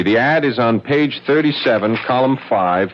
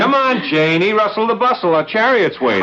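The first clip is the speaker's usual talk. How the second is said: high, bright calling out to hurry her along; cheerful hurry-up call; 11 semitones higher than usual. Acted. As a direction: chivvy her out the door with a high sing-song call. Husband hurrying wife out the door.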